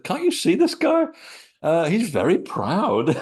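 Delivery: high, loud, incredulous, breaking into laughter; amazed laughing. Retold or imitated. Telling the puzzled group about the creature, amazed and laughing. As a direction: retell the disbelief, laughing through it.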